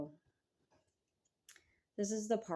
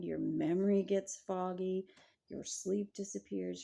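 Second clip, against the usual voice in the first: ordinary talk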